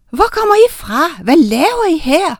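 high, loud questions from a crowd; excited, high-pitched challenge; clamoring questions. A narrator's reading. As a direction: call out in startled demand, pitch leaping up. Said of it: "lød det fra alle sider".

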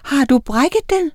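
high, loud anxious questions; worried shout. A narrator's reading. Miranda asks Oline about the hurt wing, calling out. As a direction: cry out the questions with rising alarm. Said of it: "råbte Miranda".

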